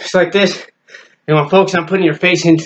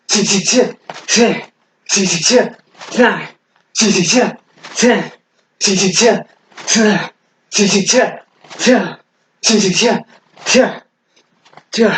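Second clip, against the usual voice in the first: higher, sharp hissed bursts with each strike; staccato martial arts breaths; punching effort noises; 5 semitones higher, slower than usual. Real, not acted. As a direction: let a short exhaled "tss" go on every punch.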